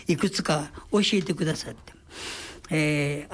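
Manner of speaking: ordinary talk, nothing marked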